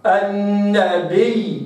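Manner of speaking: النبي with the ya drawn out slowly; slow recitation. Shown as a demonstration. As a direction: hold the ya in النبي. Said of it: "هكذا". (النبي ببطء مع إظهار الياء)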